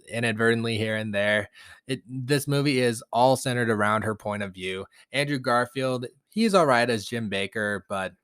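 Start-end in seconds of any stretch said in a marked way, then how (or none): none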